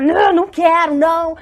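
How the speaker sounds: high, loud acted tantrum refusal; birra com raiva. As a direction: refuse like an angry child, acted out. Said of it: "um dia tá com raiva e falar". (encenando uma recusa com raiva)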